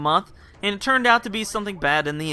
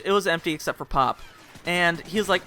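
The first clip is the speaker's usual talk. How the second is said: ordinary delivery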